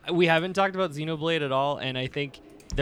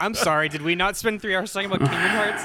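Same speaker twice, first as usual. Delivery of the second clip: raised, louder teasing outrage; mock indignant; 6 semitones higher, 4 dB louder than usual. Real, not acted. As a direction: playfully scold the group.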